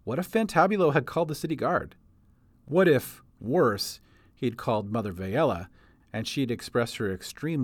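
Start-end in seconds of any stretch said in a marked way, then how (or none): none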